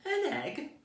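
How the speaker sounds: put-on a boy shocked by the gift; surprised boy. A narrator's reading. Danny finds a huge egg under the tree, squeaking in surprise. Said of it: "Danny said".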